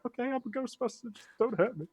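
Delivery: high, soft mock-scared voice; small high voice placating a threatening drunk; timid surrender. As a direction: give in meekly, pitched right up. Giving in to drunk stranger.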